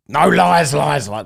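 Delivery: loud, high mock outcry; mocking protest. Streamer mocking opponents who panic at a name, loud mock protest. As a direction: imitate opponents yelping in panicked denial. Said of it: "They all sound like that".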